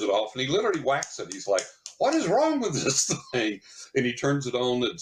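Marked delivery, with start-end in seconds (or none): 2.0-3.6 much higher, laughing mock exasperation; hammed-up frustrated workman; exasperated. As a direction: imitate the puzzled repairman with a laugh. Gas man whacks the detector.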